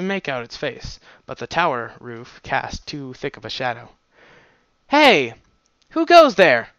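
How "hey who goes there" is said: high, loud challenge of a nervous guard; female guard crying out. Acted. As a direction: call out trying to sound threatening. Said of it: "a feminine voice cried".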